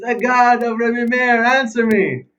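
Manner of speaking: high, loud, drawn-out cry to God; acted loud plea. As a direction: call it out slowly at full force. Soldier blurts out his prayer.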